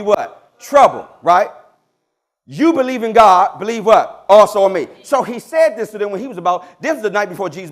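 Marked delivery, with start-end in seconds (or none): 0.6-1.5 1 loud call out. Real, not acted. Congregation prompt, preacher calls out. 2.5-4.9 1 loud preaching call. Real, not acted. Preacher calls scripture for response.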